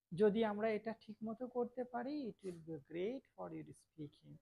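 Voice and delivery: ordinary delivery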